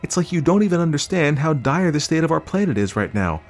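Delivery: high, urgent wife; earnest, high-pitched appeal; pleading justification. A narrator's reading. Wife pleads her case.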